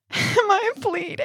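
put-on high, tearful child's wail; imitating sobbing child. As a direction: mimic a crying little girl. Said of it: "runs out to the kitchen sobbing".

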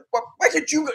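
high-pitched exasperated stammer; retold whiny protest, voice jumping up high; mock exasperated comeback; irritated retort. Acts out irritated reply to request.

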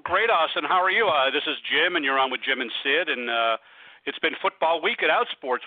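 ordinary talk

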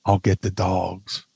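low, slow mock tough-guy line; drawn out and deep, leaning in confidentially; imitated wrestler volunteering, slow and low; Tracy Smothers. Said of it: "He leans into Tommy and goes".

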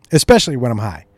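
normal talking voice

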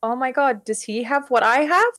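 high, wondering questions; astonished realization. Retold or imitated. Voicing own childhood astonished realization.

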